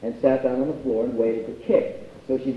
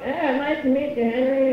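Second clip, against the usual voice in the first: put-on much higher, strained old man's greeting; a stiff, frail father offering his hand; frail arthritic dad; 13 semitones higher than usual. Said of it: "he's arthritic in one side".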